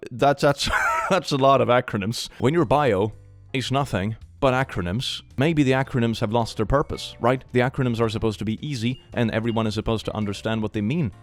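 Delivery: ordinary talk, nothing marked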